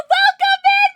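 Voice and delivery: high, loud cheer of welcome; real gleeful shout; excited greeting. Real, not acted. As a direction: belt the welcome out to the raid. Greeting raiders.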